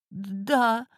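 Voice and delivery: high, shaky little yes; trembling voice. Acted. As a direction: answer with a quivering voice. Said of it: "дрожащим голосом произнесла клиентка".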